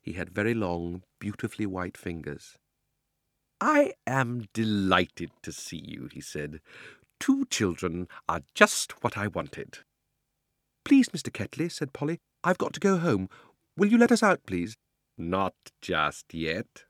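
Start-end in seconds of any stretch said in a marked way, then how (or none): 3.6-9.8 put-on slow, relishing sinister old man; slow and menacing with the children; creepy old uncle. Narrator voices Uncle Andrew. 10.9-14.8 put-on high, anxious young girl begging; frightened girl pleads with the uncle; pleading girl. Narrator voices Polly. 15.2-16.9 put-on slow, relishing sinister old man; slow and menacing with the children; creepy old uncle. Uncle Andrew refuses.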